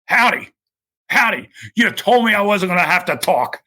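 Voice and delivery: mock-indignant yelp, up high; exasperated Arnie. Arnie protesting to Howdy about speaking, high indignant yelp. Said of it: "He just goes".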